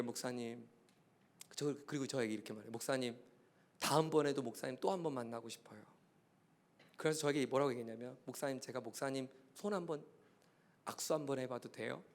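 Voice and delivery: soft, low, weak request; dying young man asking his pastor for a handshake; frail young patient. Retold or imitated. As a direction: speak softly like a gravely ill young man. Frail dying youth voiced.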